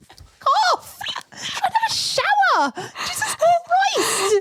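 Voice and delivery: hammed-up angry shriek at the intruder; mock yell. To her friend poking in at the shower, a high hammed-up yell. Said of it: "And I'm like".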